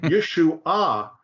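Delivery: YESHUA as yeh-shoo-AH; third-syllable accent. Shown as a demonstration. Teacher saying the word for salvation, YESHUA accented on AH. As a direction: push the stress to the final AH of YESHUA. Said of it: "is how you say the word salvation".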